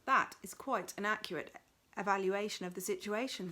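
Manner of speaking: ordinary delivery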